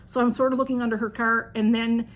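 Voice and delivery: usual speaking voice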